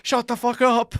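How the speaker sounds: hammed-up angry yell at a friend; imagined angry retort. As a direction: snap back in exaggerated outrage. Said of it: "It's like".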